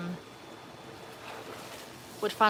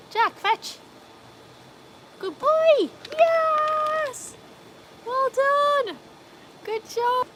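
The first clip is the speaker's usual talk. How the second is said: dog praise voice; 4 dB louder, much slower than usual. Real, not acted. Praising dog fetching ball.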